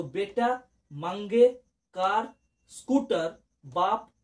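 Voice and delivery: slow repeat. Shown as a demonstration. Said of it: "repeat".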